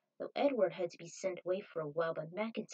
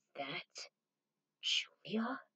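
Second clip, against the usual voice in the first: hushed question; 3 semitones lower, slower than usual. Acted. Jet sees Julia, hushed whisper. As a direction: breathe it out in disbelief.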